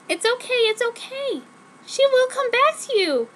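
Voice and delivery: put-on high girly voice soothing him; mock comforting. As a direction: coo it like a hammed-up teen heroine. Heroine comforting boyfriend.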